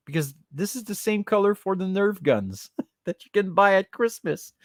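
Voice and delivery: normal talking voice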